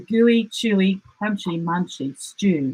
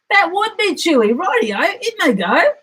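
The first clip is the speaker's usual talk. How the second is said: much higher, eager sly dingo; gleeful dingo; 11 semitones higher, 3 dB louder than usual. A narrator's reading. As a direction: bounce up with crafty glee. Dingo agrees, very high.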